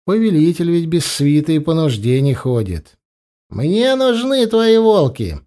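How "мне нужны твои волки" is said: slow, high-pitched blunt demand. A narrator's reading. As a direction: state the demand outright, stretching the words. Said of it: "без обиняков сказал Учай". (прямо, без обиняков)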